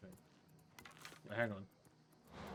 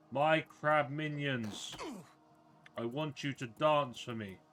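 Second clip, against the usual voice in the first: loud, booming mock-grand wizard proclamation; hammed-up self-proclaimed crab wizard; pompous wizard; 2 semitones higher, 8 dB louder, slower than usual. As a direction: lord it over the crab minions. Wizard commands crabs.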